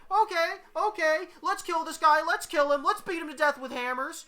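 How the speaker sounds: high, loud rabble voices; high-pitched acted war cry; mob shouting. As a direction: shout like a riled-up little mob. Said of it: "all begin shouting".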